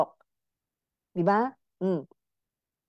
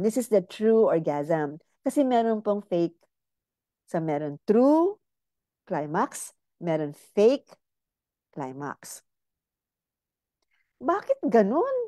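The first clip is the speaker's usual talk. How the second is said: usual speaking voice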